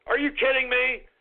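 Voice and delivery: ordinary talk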